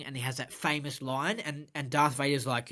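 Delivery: ordinary talk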